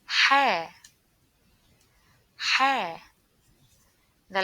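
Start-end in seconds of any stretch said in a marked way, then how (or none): 0.1-3.0 Ha breathed out hard like after spicy food; breathy spicy-food Ha. Shown as a demonstration. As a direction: exhale Ha from the throat. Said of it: "like the sound when we eat spicy food".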